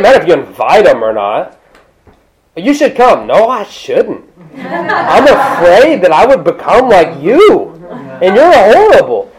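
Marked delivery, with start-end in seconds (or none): 2.6-3.3 mock inviter. 3.3-9.3 laughing mock refusal.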